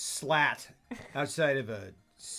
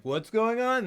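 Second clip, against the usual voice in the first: alarmed; 12 semitones higher, 9 dB louder than usual. Retold or imitated. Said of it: "they're like".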